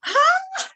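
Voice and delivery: very high, loud HA, then laughing; sudden released ha-ha; burst of laughter. Shown as a demonstration. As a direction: explode into a demonstrated laugh. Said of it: "and then go".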